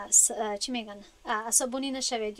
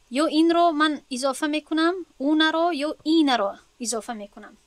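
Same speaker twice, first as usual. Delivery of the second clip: louder, pressing the point home; louder, emphatic explanation; emphatic teacher voice; 4 semitones higher, 7 dB louder than usual. Shown as a demonstration. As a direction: push it so the class hears the step. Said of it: "teacher stressing the key step".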